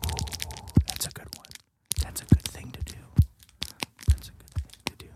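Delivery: very soft, voiceless whisper; ASMR whisper. Pretend. Host approves sounds for the ASMR minute, whispered. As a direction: murmur approval under your breath, mock ASMR.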